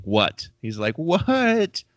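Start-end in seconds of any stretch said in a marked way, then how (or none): 1.0-1.8 high, far-off yelp of delight; happy yelling. Retold or imitated. Retelling a buddy's stunned cast, happy high yelp. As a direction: shriek it like you can't believe the cast. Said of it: "like, a happy yelling".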